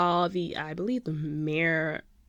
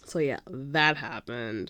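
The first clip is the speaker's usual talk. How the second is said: normal talking voice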